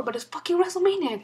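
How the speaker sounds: can't hold it in; excited outburst. Real, not acted.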